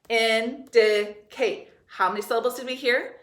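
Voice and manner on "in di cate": "Indicate" slowly in three separate, even beats; syllable by syllable. Shown as a demonstration. Teacher counting syllables with the class, syllable by syllable. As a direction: pound out "Indicate" with a gap after each syllable. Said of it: "Let's try it again".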